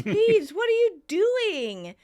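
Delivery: high, loud, drawn-out question; acted bafflement. Ross asks Phoebe at the open window, loudly baffled. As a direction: imitate an incredulous friend, stretching the words.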